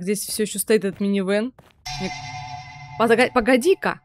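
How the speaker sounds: ordinary talk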